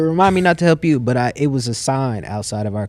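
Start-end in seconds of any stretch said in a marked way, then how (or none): 0.0-1.0 higher, louder imitation of a put-out teacher; retold retort. Teacher answering a student who refused his help, retold retort. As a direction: throw it out as a loud retold comeback.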